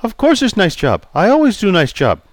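put-on polite Chinese-accented barber greeting. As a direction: voice Pei the barber, courteous. Pei offended.

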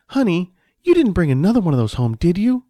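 lightly voiced nagging wife; disapproving wife. A narrator's reading. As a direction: question the husband with reproach. Wife scolds husband about treat.

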